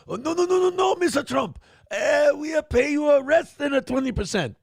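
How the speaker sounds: a hammed-up Filipino accent begging Mister Trump; comic Filipino accent, high and shouted; mock Filipino accent. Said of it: "they all talk like Manny Pacquiao".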